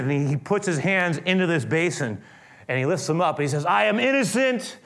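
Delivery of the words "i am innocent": higher, emphatic, slowing proclamation; publicly disclaim guilt before a crowd, acted out; solemn declaration. Pilate publicly declares his innocence.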